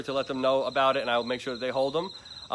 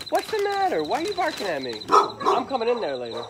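much higher sing-song coo at a barking dog; 8 semitones higher than usual. Real, not acted.

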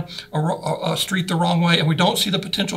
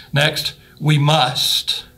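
very slow, must pressed; stress on must; 2 semitones lower, 3 dB louder, much slower than usual. Shown as a demonstration. Preacher moves to must, very slow and stressed. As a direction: draw out we must with force. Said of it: "put the emphasis on those".

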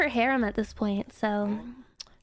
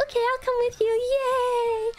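much higher, bubbly little "Yay!"; eager tagalong; 11 semitones higher than usual. Retold or imitated. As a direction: tag along like an excited puppy. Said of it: "I'd be like".

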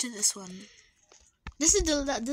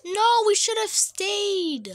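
dismayed wail; 8 semitones higher, 6 dB louder, slower than usual. Real, not acted. Regret as fire rises.

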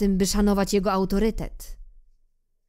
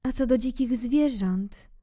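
higher, proud flower voice, hiding her hurt; a vain rose saying goodbye, voice pitched higher; 7 semitones higher than usual. Acted. Rose bids little prince farewell, proud and higher.

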